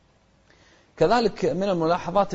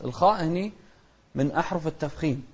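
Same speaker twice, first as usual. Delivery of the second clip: ordinary talk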